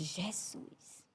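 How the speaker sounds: breathless whisper of the name; whispered gasp. Retold or imitated. Reacting to a sudden death. (sussurro assustado)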